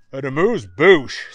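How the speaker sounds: loud, flat echo of "amuse-bouche"; puzzled echo. Acted. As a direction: repeat "amuse-bouche" baffled. Chet echoes, puzzled.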